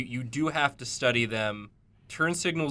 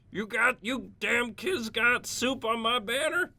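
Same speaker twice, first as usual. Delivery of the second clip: high-pitched cranky grandpa rant; crotchety scolding old man; 11 semitones higher than usual. Acted. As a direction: scold the kids in a put-on high old-timer voice. Acted scolding old banner owner.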